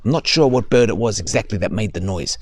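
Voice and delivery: ordinary delivery, nothing marked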